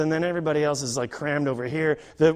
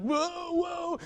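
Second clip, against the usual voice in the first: nervous whoa; 11 semitones higher, 7 dB quieter than usual. Retold or imitated. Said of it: "these two Spanish guys were like".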